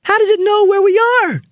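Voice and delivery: very high, frightened wail; high-pitched, alarmed child's question; panicked question. A narrator's reading. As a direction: wail it in terror. Frightened Marie cries out in story.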